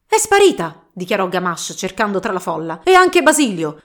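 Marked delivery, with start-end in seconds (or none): 0.1-0.8 high, loud alarmed cry; a lightly voiced cry of alarm; jealous suitor crying out the bride is gone. Father finds daughter gone. 2.8-3.8 high and loud indignant cry; a jilted suitor crying out; indignant outburst. Acted. Suitor realises the rival vanished.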